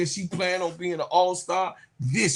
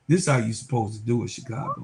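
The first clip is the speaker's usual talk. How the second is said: smug taunt; 8 semitones lower, faster than usual. Real, not acted. Vlogger taunts Chicago after the welcome party, quick hushed sneer. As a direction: rattle it off under your breath like an inside joke.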